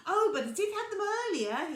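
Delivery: high, loud cheerful rabbit voice; bouncy, eager explanation; Rabbit explaining. A narrator's reading. Rabbit explains to Little Bear, high and lively. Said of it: "he explained".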